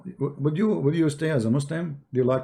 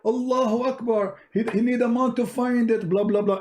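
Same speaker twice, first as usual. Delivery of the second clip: much higher, mocking triumphant cry; exploding sheikh; 8 semitones higher than usual. Sheikh erupts over needing a month, high and mocking. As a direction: go off like a bomb. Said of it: "like a bomb will explode everywhere".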